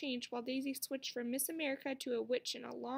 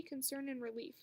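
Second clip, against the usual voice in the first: ordinary delivery, nothing marked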